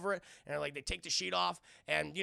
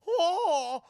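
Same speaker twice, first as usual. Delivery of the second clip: high, loud drawn-out "oh"; mock hooting cry; 12 semitones higher, 10 dB louder than usual. Human gargoyles walking around with briefcases, high held hoot. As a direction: hold a silly high hoot.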